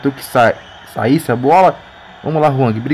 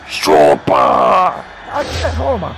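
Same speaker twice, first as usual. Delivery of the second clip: triumphant shout; 11 semitones lower, much slower than usual. Real, not acted. Taunting after the goal.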